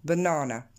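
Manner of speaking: BANANA as buh-NAA-nuh, falling at the end; second-syllable stress. Shown as a demonstration. Teacher showing where the apostrophe falls, English-stressed banana. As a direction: punch the middle syllable of BANANA. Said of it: "la petite apostrophe devant".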